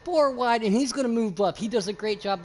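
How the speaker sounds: loud, high play-by-play; excited race call. Real, not acted. Commentator calling a four-wide move, excited shout. Live call of close racing.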